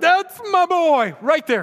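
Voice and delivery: imitating God as a beaming, overjoyed father; proud father. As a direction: burst out like a dad bursting with pride. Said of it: "I actually think that God's like".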